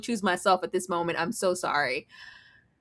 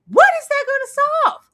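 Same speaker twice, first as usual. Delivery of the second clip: loud, much higher outrage; outraged question; 8 dB louder than usual. Real, not acted. Host outraged at husband leaving, loud high question.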